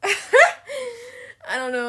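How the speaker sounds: high, slow, laugh-crying words; laughing helplessly. Real, not acted. Still cracking up at her sex joke, laughing helplessly. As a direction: let the laugh drag the words out.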